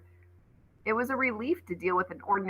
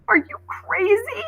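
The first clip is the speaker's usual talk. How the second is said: high, slow, incredulous demand; shocked, demanding question; 4 dB louder than usual. Acted. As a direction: demand it in disbelief. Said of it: "Coco demanded".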